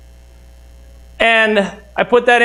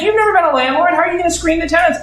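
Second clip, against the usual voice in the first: imitating wife; 7 semitones higher, faster than usual. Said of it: "She's like".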